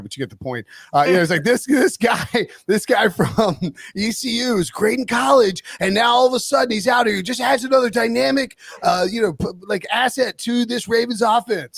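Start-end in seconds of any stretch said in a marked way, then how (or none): 1.4-11.8 Collinsworth impression. Imitating a TV analyst praising the rookie, higher. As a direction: gush like a broadcast color man. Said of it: "I'm doing Collinsworth there".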